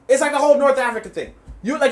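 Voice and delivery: normal talking voice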